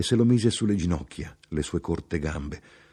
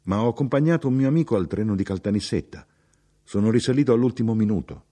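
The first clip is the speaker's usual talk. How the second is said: raised, quick, eager explanation; higher, louder, hurried chatter; chatty, contented traveller, explaining; 5 semitones higher, 4 dB louder, faster than usual. Acted. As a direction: explain yourself busily to a fellow passenger. Plays the talkative traveller explaining.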